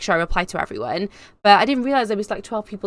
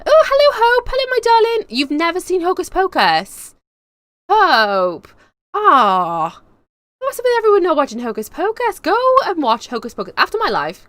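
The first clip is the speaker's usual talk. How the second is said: loud, high playful disbelief; mock outrage; 10 semitones higher, 7 dB louder than usual. Streamer scolding Hope for missing Hocus Pocus, mock outraged. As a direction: scold a friend in high, loud, hammed-up shock.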